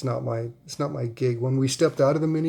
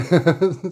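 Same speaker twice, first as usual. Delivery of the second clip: burst of laughter; 5 semitones higher, 8 dB louder than usual. Real, not acted. Laughing at daughter refusing minivan.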